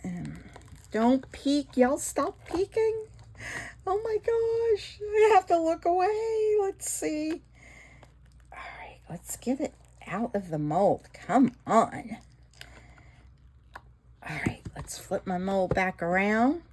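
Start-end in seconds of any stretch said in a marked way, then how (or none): 1.8-7.4 high squealing excitement; can't look, too excited. Real, not acted. Excited reveal of mold. 8.5-16.6 whisper. Real, not acted. Whispering while demolding.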